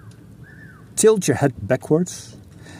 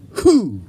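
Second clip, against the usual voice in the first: snorkel blast; 6 semitones higher than usual. Shown as a demonstration. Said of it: "blast powerfully".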